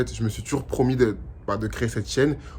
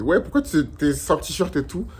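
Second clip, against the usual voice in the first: mocking viewer; 6 semitones higher, 3 dB louder than usual. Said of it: "vous allez commencer à m'insulter en mode".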